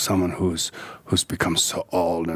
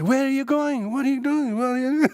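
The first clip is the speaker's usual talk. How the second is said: mock nagging father, much higher; control-freak father. Father questioning his son about going out, mock nagging and high. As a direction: grill the son like a fussy parent. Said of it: "all these control freak parents".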